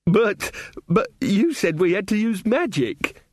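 put-on high, slow indignant girl; protesting girl voice. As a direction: stammer out a protest.

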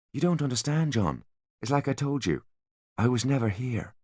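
soft, cool and quiet; calm, almost gentle menace; strangely calm. A narrator's reading. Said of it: "Artemis was strangely calm".

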